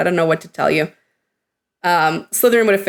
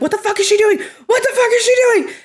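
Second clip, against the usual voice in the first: panicking; 14 semitones higher, faster than usual. Retold or imitated. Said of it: "him really panicking".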